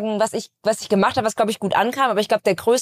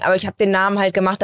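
normal talking voice

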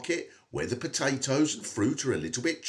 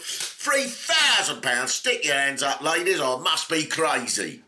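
market trader voice; 3 semitones higher than usual. Retold or imitated. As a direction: hawk it to the crowd like a barrow boy. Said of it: "started to talk like a market trader".

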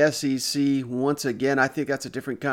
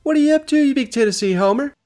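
playful teasing shout; 11 semitones higher, 8 dB louder, faster than usual. Real, not acted. Host teasing cousin.

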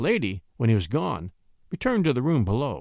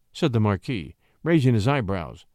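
ordinary delivery, nothing marked

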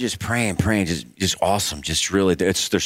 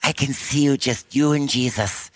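put-on slow, dreamy Canadian accent, a touch higher; 5 semitones higher, slower than usual. Prayer lady pictures God peeing in snow, slow Canadian accent. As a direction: imitate the earnest Canadian prayer, unhurried. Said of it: "she had the Canadian accent".

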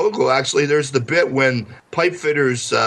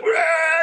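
high, shouted mock roar; 14 semitones higher than usual. Friend freaking out in the van, high shouted roar. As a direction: let out a high cartoon roar. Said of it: "And he's like".

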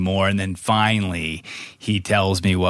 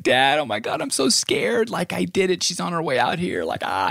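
mock scared teen; 7 semitones higher than usual. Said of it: "he's like".

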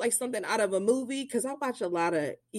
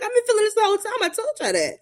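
much higher, fast, louder indignant protest; exasperated mom; 12 semitones higher, 3 dB louder, much faster than usual. Retold or imitated. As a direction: insist you told them all along.

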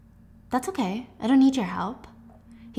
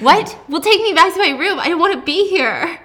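higher, louder protest; girl indignantly demanding to leave; indignant outburst; 7 semitones higher, 6 dB louder than usual. A narrator's reading. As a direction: demand to be taken back at once. Juliet indignant in Warner's bedroom.

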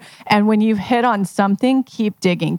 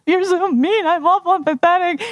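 high, whiny mock hurt protest; whiny client; 11 semitones higher than usual. Client whining back during the mirror exercise. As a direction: whine it back like a wounded friend. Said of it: "And she's like".

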